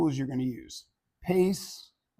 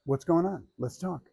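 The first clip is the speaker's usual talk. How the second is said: gentle, softer, reassuring; slowed down and calm; 3 semitones higher, 5 dB quieter than usual. Shown as a demonstration. Presenter demos a slow calming pace for one listener, gentle and soft. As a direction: ease off and calm them down. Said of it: "I can slow it down and go".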